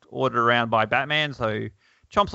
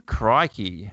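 ordinary delivery

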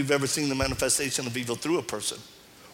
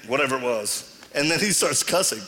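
usual speaking voice

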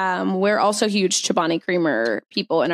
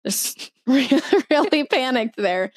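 laughing; 5 semitones higher, slower than usual. Real, not acted. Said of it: "the pure, whoa".

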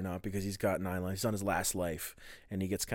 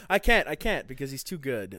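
ordinary delivery

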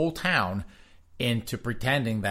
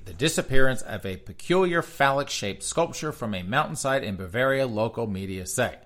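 ordinary talk, nothing marked

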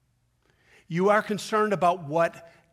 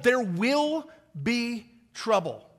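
ordinary talk, nothing marked

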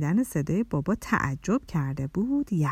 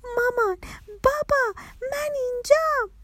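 high-pitched joyful call; excited little child's cry; happy shout. A narrator's reading. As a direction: call out happily to parents from afar. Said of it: "با خوشحالی داد کشید".

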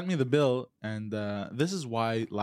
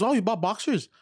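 higher, louder prying mom; nosy mom impression; 9 semitones higher, 4 dB louder than usual. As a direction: poke at him like a nosy mother. Mom voiced in story.

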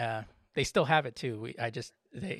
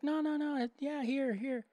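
pitched up, pressing the plate on him; high insistent neighbor; 14 semitones higher than usual. Retold or imitated. Neighbors urging him to take the plate, high insistent. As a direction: insist kindly, high and quick.

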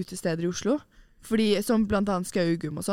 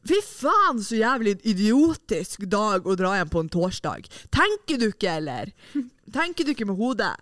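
mock drunk rant. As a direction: play the drunk friend scolding in a raised, slowed voice. Imitating drunk friend ranting.

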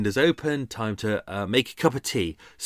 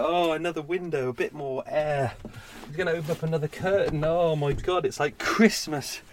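sarcastic play-by-play of himself, building to higher pitch; hammed-up awe rising ever higher; mock-excited commentator; 4 semitones higher than usual. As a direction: gush over trivial tea-making like a thrilled fan. Mock fan commentary on making tea.

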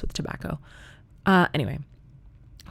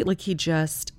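normal talking voice

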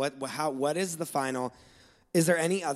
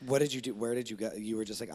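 normal talking voice

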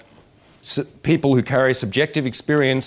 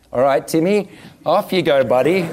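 put-on higher, cheery send-off to a child; teacher to small child; 5 semitones higher than usual. Sending little Timmy off to talk to a girl, teacher to small child. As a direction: gently nudge a little kid on his way. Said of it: "teach it to a kindergarten child".

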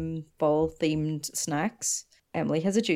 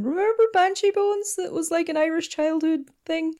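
much higher excited nostalgic voice; nostalgic fan voice; 11 semitones higher than usual. Retold or imitated. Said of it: "people going".

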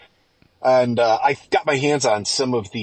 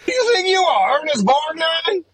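much higher, hammed-up scolding voice; Mother Nature; 3 dB louder than usual. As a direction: tear into them in an odd put-on accent. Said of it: "I don't know what that accent is".

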